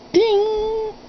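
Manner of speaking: high, ringing "ding" held on one pitch; imitated piano note. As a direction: mimic a struck piano key being tuned.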